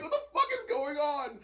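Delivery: high-pitched comic despair; mock bewildered whine. Junkie alone in the car at night, high mock whine. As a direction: whine it as a hammed-up panicking man.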